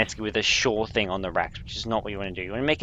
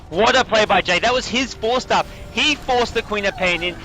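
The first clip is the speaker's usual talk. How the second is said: excited play-by-play; 7 semitones higher than usual. Real, not acted. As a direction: call the fight like it just broke open. Big teamfight play called live.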